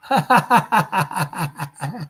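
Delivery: a short laugh drowned in echo. Shown as a demonstration. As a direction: laugh through an echo effect. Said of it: "I can get the echo chamber".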